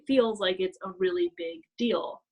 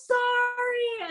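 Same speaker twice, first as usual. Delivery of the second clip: high, loud gasped sorry; imitating her own horrified sorry; startled apology; 13 semitones higher, 6 dB louder, much slower than usual. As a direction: blurt out a shocked apology.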